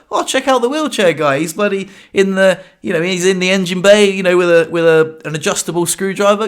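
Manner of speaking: hammed-up admiring shout across the car park; cheering onlooker. Car park strangers hailing the wheelchair guy, mock cheering. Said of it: "and they were like".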